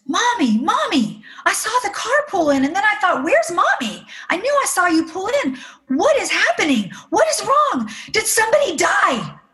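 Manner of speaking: high-pitched, alarmed child imitation; frantic daughter. Oldest daughter finding her mother hysterical in the car, frantic. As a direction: pile up panicked questions in a high girl's voice. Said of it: "And she says".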